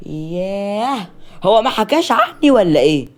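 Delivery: long drawn-out, high, loud indignation; affronted great-aunt. Acted. Great-aunt unknown to the fiancée, theatrically affronted. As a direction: act loudly put out.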